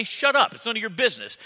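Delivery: high indignant shut up; man snapping at his friend to mind his business; defensive snap. Retold or imitated. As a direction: snap back, offended. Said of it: "you may look back and say".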